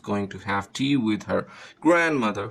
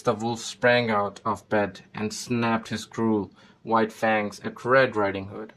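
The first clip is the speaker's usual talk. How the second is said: ordinary delivery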